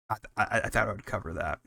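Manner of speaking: ordinary talk, nothing marked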